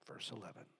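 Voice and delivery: whisper. Acted.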